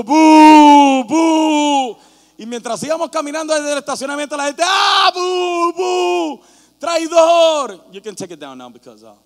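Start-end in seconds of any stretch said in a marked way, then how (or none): 0.0-2.0 loud jeering boos; booing crowd. Retold or imitated. Preacher imitating the booing crowd, loud jeers. 4.7-7.9 loud, high boos and a shouted traitor; jeering crowd. Retold or imitated. Preacher imitating fans jeering him as traitor, shouted.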